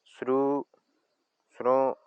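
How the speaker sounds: sru said with a rising Bribri tone. Shown as a demonstration. Teacher demonstrating the rising tone on sru. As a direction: let sru climb in pitch. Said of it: "Se representa mediante un acento circunflejo".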